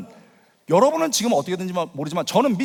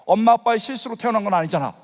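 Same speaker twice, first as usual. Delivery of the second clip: ordinary talk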